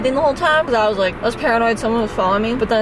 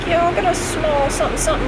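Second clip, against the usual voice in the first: casual order; 8 semitones higher, 3 dB louder than usual. Retold or imitated. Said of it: "so I was just like".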